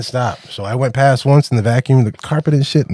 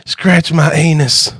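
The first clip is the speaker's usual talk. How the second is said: slow, higher, louder old-lady imitation; pleading old lady; 5 semitones higher, 5 dB louder, much slower than usual. Old lady turning on her side, mock-pleading request. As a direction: draw out the mock plea slowly and loudly. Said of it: "She's like".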